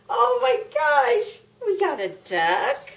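high excited squeal. Real, not acted. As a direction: gasp it out with delight. Nana unpacks toy animals, excited.

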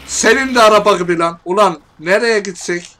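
real rage shout; furious cursing. Real, not acted. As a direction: shout the curse at the car. Raging at the car.

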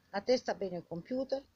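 ordinary talk, nothing marked

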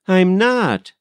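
put-on high, emphatic child's protest; indignant denial. A narrator's reading. Molly answering the scolding maid, indignant denial. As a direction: deny it sharply, pitched high.

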